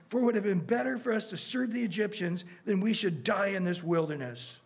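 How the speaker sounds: high-pitched, accusing mock complaint; blaming complaint. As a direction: read it as an aggrieved crowd turning on their leader. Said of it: "so they start blaming Moses".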